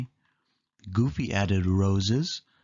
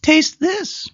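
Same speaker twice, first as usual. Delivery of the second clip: much higher, louder cartoon mouse; Minnie voice; 8 dB louder than usual. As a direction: offer it brightly as Minnie. Minnie offers a spoon.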